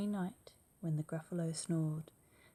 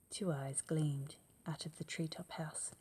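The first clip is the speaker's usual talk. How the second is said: plain reading voice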